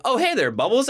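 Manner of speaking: breezy, unbothered hello; casual dog driver. Retold or imitated. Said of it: "he's like".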